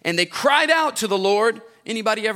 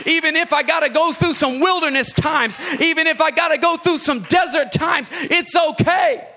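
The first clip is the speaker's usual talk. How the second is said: shouted preaching, higher and louder; preaching at full force; 7 semitones higher, 5 dB louder than usual. Acted. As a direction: cry freedom to the congregation at full force.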